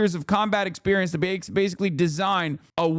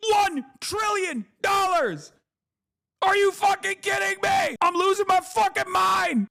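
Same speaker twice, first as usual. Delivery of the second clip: very high, strained yells of disbelief; exasperated shouting; 13 semitones higher than usual. Real, not acted. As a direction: rant as if losing your mind over the cost. Ranting about trillion-dollar jet.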